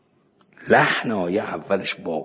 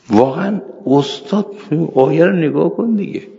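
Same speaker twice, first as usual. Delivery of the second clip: excited protest; 8 semitones higher, 4 dB louder than usual. Retold or imitated. Student protests to teacher.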